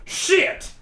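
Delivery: shocked. Real, not acted. Reacts to MoviePass news.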